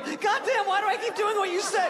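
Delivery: high, put-on voice, fed up; high exasperated whine. Passenger grumbling to the pilot while sitting down, high whine. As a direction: complain while giving in.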